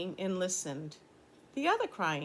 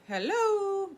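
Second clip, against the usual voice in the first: high echoing hello, much higher; 12 semitones higher, 3 dB louder than usual. Shown as a demonstration. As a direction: let it ring back like an echo. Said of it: "back just the way that I said it".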